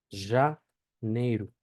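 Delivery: Janeiro drawn out as "zha... NEY-ru"; slowed pronunciation demo. Shown as a demonstration. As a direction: break Janeiro slowly, buzzing zh first. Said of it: "en la palabra janeiro".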